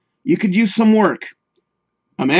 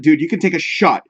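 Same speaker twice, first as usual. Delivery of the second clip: ordinary delivery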